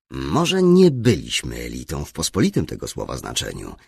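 usual speaking voice

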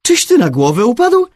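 loud, high-pitched disbelief; astonished. A narrator's reading. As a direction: blurt it out, utterly astounded. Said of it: "nie był nawet rozdrażniony, tylko zdumiony". (zdumiony, podniesiony głos)

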